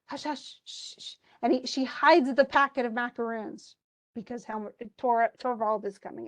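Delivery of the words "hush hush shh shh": fading from a soft hush into a breathy shh; hushing whisper. Acted. Rank hushing Nora as Helmer comes in, whispered. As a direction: shush them like someone's at the door.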